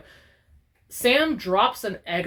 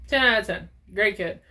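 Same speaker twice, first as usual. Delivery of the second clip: ordinary talk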